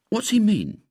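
ordinary delivery

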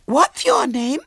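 put-on very high little girl voice; Violet Elizabeth. A narrator's reading. Small girl questions her visitor, high and childish. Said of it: "said Violet Elizabeth".

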